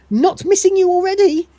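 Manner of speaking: loud, high-pitched parting taunt; hammed-up spiteful sing-out. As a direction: call it after them tauntingly. Machine taunts departing Dave.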